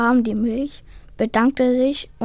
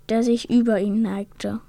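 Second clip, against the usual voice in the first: plain reading voice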